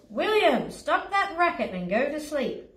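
storybook dad calling out a telling-off; booming dad. A narrator's reading. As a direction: boom it up the stairs like a cross father. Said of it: "Dad's voice boomed up the stairs".

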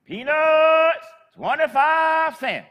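loud, high, slow street-vendor cry; drawn-out hawker's shout; vendor's call. Retold or imitated. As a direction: call it out like an old peanut seller. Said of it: "and she'd say".

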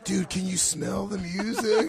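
stoned slow dude. Retold or imitated. Mocking stoned guy.

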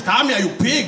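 put-on heavy Zulu-accented scolding; changed Zulu accent. Angry parent calls the boy over in a thick Zulu accent. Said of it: "Even the accent changes".

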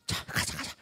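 hushed whisper. Acted. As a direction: whisper to the others, let's go. Spies set off whispering. (속삭이듯 "가자")

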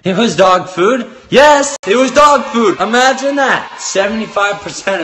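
loud high shout. Real, not acted. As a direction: shout the verdict. Shouting his review.

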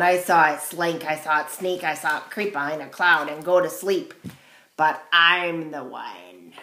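loud storybook crow; a hint of a self-assured crow; lightly voiced boastful crow. A narrator's reading. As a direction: declare it loudly as the crow.

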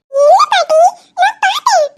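put-on high little girl insisting; a small kid sure of what she saw; insistent child voice. Daughter insists to grandma she saw it, high child voice.

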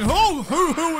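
high, loud mock owl hooting; owl hoots. Gamer hooting like an owl while watching for zombies. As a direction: hoot like an owl in the night. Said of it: "like I'm a frickin' owl going".

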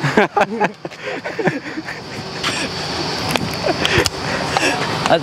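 long, breathy real laughter. Real, not acted. Prankster teasing the fan after the reveal, laughing.

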